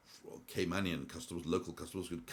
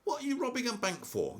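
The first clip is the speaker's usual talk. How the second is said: a cashier shouting at the masked robber she recognizes; 13 semitones higher, 3 dB louder than usual. Retold or imitated. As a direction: call out across the bank hall, high and astonished. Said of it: "she shouts out to him and she says".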